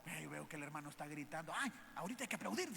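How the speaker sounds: imitación burlona de un feligrés distraído, primero bajito y luego rápido; feligrés distraído burlón. Retold or imitated. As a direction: imitar al que aplaude sin escuchar. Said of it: "uno puede estar ahí de fondo". (feligrés distraído imitado con burla, rápido)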